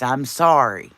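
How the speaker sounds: ordinary talk, nothing marked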